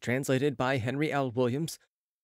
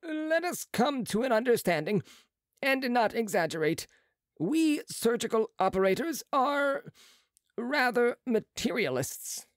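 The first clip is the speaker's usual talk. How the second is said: higher, brisk surgeon's voice; a proud young surgeon, pitched up; Marat's voice; 11 semitones higher than usual. A narrator's reading.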